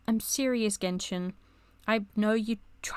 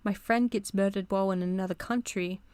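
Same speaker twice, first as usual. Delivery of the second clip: normal talking voice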